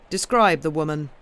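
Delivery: loud ducal command. A narrator's reading. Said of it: "commanded the duke".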